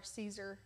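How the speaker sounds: "Caesar" as "SEE-zer", soft and low; correct pronunciation. Shown as a demonstration. Finishing the spelling for co-host, "Caesar" as "SEE-zer". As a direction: say "Caesar" softly with a long "ee". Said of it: "How do you spell that?".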